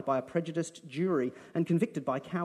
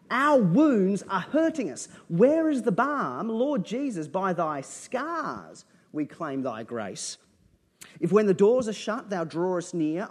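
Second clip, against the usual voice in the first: louder, higher, slow fervent recital; poem reading; 4 semitones higher, 7 dB louder, slower than usual. Acted. Preacher reciting a poem to his congregation, fervent and slow. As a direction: read the poem like a prayer rising.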